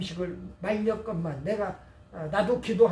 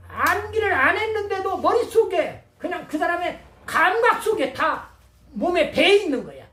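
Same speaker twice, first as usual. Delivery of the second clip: raised, pressing sermon storytelling; impassioned preaching; 6 semitones higher, 4 dB louder than usual. Real, not acted. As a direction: build it up with emphatic high-pitched bursts.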